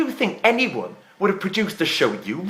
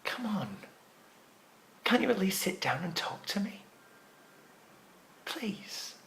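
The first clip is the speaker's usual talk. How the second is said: very soft, low, desperate begging; subdued, breath-held coaxing; hushed pleading; 5 semitones lower, 10 dB quieter than usual. Acted. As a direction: beg gently, almost down to a whisper. Desperate plea to Kim to stay.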